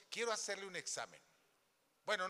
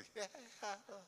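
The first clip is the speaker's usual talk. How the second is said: laugh; 6 semitones higher, 6 dB quieter than usual. Real, not acted. Laugh at silence.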